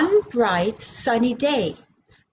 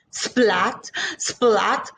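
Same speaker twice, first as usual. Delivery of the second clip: usual speaking voice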